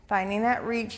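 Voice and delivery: ordinary delivery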